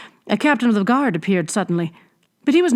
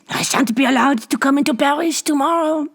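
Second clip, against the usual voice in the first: coarse hag; 8 semitones higher than usual. A narrator's reading. Old hag taunts Bibot with her trophy, coarse. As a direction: gloat like a ghoulish crone. Hag's voice.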